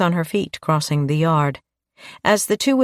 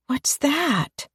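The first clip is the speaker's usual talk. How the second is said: put-on a higher, softer child's question; little girl; 9 semitones higher, 4 dB quieter than usual. A narrator's reading. Chloe asking Dave about the game, curious child. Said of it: "said Chloe".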